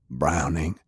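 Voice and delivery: low rough call of the name Browning; wounded heavy. Acted. Sullivan addresses Browning.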